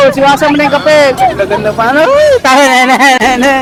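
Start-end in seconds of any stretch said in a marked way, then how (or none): none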